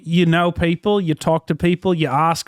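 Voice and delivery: put-on Aussie accent, held steady; Australian accent. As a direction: fake Australian accent in character. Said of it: "continued the Australian accent".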